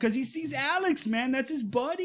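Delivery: high-pitched, emotional retelling; getting emotional. Real, not acted. Fan telling how a buddy saves his friend, getting emotional. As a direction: voice lifted high, moved by the friendship. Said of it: "why am I getting emotional".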